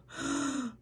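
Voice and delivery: sharp imitated gasp of shock; retold shocked gasp. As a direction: gasp like a doctor seeing bad results. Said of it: "you just hear his pediatrician go".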